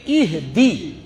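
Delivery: slow ایہ دی with short Arabic i; ایہ دی drawn out as "ih-di"; correct Arabic ihdi. Shown as a demonstration. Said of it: "اہ دے نہیں ہے، بلکہ". (آہستہ عربی تلفظ ایہ دی)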